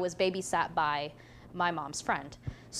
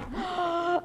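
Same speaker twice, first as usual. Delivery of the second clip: shocked gasp; 9 semitones higher than usual. Retold or imitated. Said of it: "so in shock that I said this".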